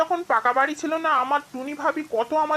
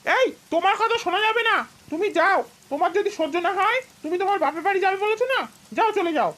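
angry husband, voice pitched up; hot-headed anger; 3 dB quieter, much faster than usual. Acted. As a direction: tell your wife off heatedly. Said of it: "মাথাটা খুব গরম হয়ে গেল".

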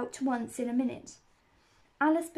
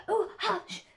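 higher-pitched anxious shushing; frightened hushing; 9 semitones higher than usual. A narrator's reading. Rabbit silences Alice's laughter, frightened. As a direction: hush them in a fright. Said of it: "whispered in a frightened tone".

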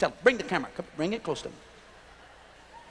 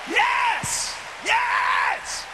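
excited cheer; 14 semitones higher than usual. Real, not acted. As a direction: cheer it up high. Excited cheer after laugh.

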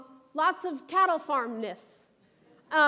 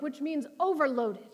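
usual speaking voice

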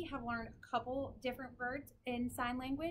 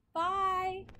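normal talking voice

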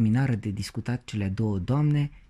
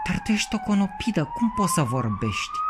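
incredulous question. A narrator's reading. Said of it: "întrebă copilul".

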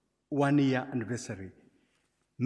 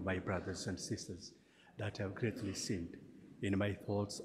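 soft, low, humble prayer recitation; hushed penitential prayer; 5 semitones lower, 6 dB quieter than usual. Acted. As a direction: murmur the confession prayerfully. Recites the Confiteor.

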